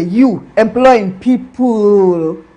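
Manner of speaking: loud, slow, drawn-out scoff in a put-on voice; a loud friend laughing at your plans; mocking sneer. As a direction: ridicule the idea as you say it. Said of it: "That's how they say".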